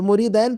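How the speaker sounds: मुरीदैन ending in "-dain"; imitating the misreading मुरीदैन, "-dain"; mistaken vowel. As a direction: say मुरीदैन with an ai before the n. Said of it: "वो गलती करते हैं".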